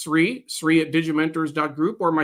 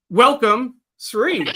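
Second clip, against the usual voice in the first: bright, raised on-air greeting; higher, louder welcome to the co-host; excited welcome; 7 semitones higher, 4 dB louder, slower than usual. Real, not acted. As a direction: call him onto the show with energy.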